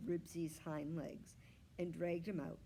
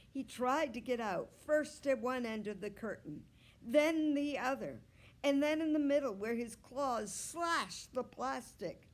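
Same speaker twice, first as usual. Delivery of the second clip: plain reading voice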